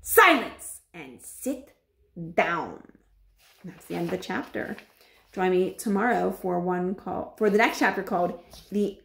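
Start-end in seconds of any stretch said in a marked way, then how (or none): 0.0-3.0 commanding witch. A narrator's reading. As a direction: order them slowly, easing down at the end.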